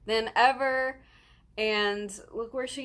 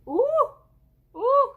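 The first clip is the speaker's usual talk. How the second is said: overwhelmed squeal; 9 dB louder than usual. Real, not acted. Overwhelmed fan gushing about [name].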